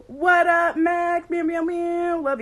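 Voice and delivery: loud, high-pitched playful hello; excited greeting. Real, not acted. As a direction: squeal it out to a friend joining. Greeting viewers joining the stream.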